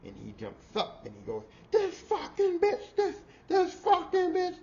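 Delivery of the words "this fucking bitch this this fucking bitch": high, slow, strained screeching rant; mock furious screech, pitched way up. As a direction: shriek it out like a man losing it. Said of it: "he's just bitching her to death".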